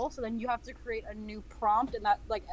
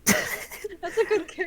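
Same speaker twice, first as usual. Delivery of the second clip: laughing; 7 semitones higher, 4 dB louder than usual. Real, not acted. Laughing at answers.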